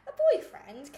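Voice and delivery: put-on loud, startled "A boyfriend?"; surprised echo. Woman startled at being asked about a boyfriend, loud surprised echo. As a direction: blurt it back in disbelief.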